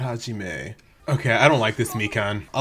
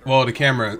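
ordinary talk

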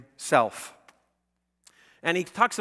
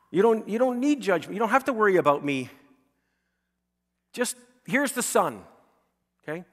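mock sunny cheer; 7 semitones higher, 5 dB louder than usual.

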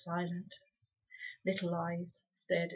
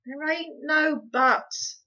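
slow and firm, louder; 8 semitones higher, 9 dB louder, much slower than usual. Acted. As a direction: put the foot down. Pod firm.

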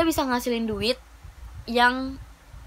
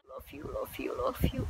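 soft, whispered affection; tender whisper; 2 semitones higher, 10 dB quieter than usual. Real, not acted. Vlogger sending love to her viewers, whispering. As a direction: whisper it lovingly, barely voiced. Tender thanks to fans after crying.

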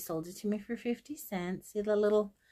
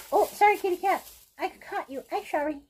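high sweet pet voice; talking to the cat; 11 semitones higher, 6 dB louder than usual. Real, not acted. Sorry to the cat caught in the bag, high pet voice. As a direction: coo an apology to the kitty.

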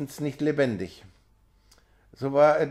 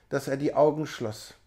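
ordinary delivery

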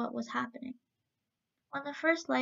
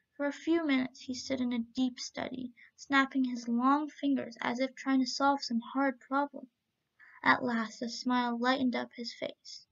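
plain reading voice

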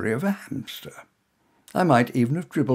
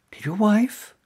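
higher voiced woman, warming as she remembers; curious woman; 8 semitones higher, slower than usual. A narrator's reading. Woman placing the stranger from the theatre, higher and brightening.

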